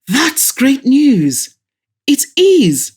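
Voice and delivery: thrilled cry of joy; excited. Acted. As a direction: burst with delight at the news. Excited good news on call.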